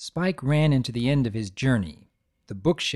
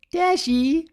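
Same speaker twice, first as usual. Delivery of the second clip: gasping call; 4 dB louder than usual. Acted. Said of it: "Pinkie gasped".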